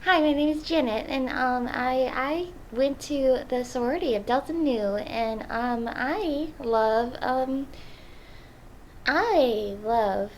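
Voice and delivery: put-on drawn-out American sorority girl voice; put-on American accent. Undercover Australian posing as Janet, put-on American accent. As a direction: introduce yourself in an exaggerated American accent. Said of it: "my American accent, which is this".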